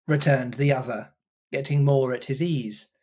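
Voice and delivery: plain reading voice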